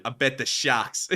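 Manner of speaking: put-on a light Boston accent echo. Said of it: "Boston accent".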